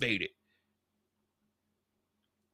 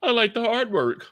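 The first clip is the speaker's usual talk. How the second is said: pompous executive; 9 semitones higher than usual. Pretend. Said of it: "go in there and say".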